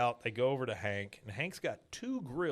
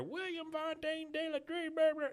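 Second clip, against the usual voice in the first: high-pitched imitation of hollering a full name; hammed-up call, pitched way up, trailing into blah; mock scolding yell. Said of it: "and then you hear".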